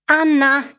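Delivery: father calling the maid to the kitchen; urgent shout for Anna. A narrator's reading. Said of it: "gridò il padre attraverso l'anticamera".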